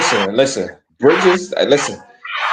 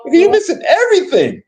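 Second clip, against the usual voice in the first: high mock exasperation; 12 semitones higher than usual. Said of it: "you were like".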